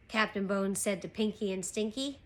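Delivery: usual speaking voice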